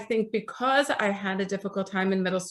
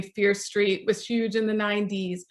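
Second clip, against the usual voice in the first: normal talking voice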